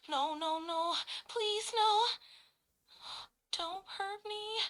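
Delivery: high, pleading, rising panic; fearful pleading. Acted. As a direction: beg for your life. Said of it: "whispering with fear".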